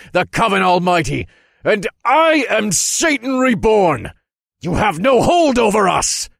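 booming battle declaration, pitched high; defiant shout building to full volume; loud defiant echo. A narrator's reading. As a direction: proclaim it loudly to defy the spirits. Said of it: "my voice emerged as an equally loud echo".

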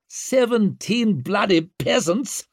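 high, slow outburst of outrage; outraged high-pitched protest; sudden fury. A narrator's reading. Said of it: "gripped the bars in a sudden fury".

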